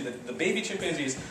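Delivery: ordinary talk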